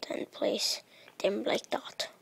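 voiceless whisper, rising on wood; whispered correction of the block name. Real, not acted. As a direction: hush it like a secret. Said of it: "I don't know why I'm speaking like this".

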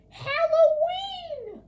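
high, slow excited yell; excited shout. Acted. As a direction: announce it like a big reveal. Big reveal of holiday.

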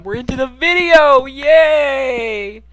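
high, loud happy cheer; excited cheer. Real, not acted. Celebrating starting the farm.

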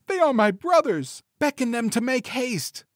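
very high, urgent wife's voice; excited cry. A narrator's reading. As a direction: cry out high to your sister. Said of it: "cried she".

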